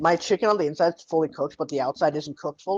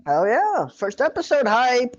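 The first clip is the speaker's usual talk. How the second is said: hype; 9 semitones higher, 6 dB louder, slower than usual. Real, not acted. Excited hype.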